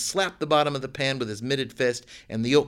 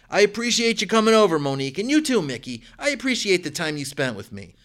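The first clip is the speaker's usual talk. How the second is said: grateful; 8 semitones higher, 5 dB louder than usual. A narrator's reading. Thanking guests loudly.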